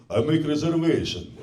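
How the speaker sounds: put-on curt demand in an Eastern European accent. Accented customer at a restaurant, Eastern European accent. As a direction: book a table in a heavy Eastern European accent. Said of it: "when you have the Eastern European accent".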